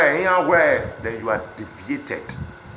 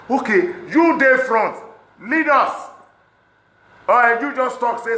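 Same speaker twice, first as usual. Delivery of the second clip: high, loud, slow dare; challenging shout; 11 semitones higher, 6 dB louder, slower than usual. Real, not acted. Daring critics to lead the protest, loud and high. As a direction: throw the challenge at your critics.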